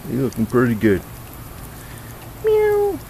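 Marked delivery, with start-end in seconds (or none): 2.4-3.0 very high squeaky cat meow; mock meow. Owner meowing back at Bootsy, very high mock meow.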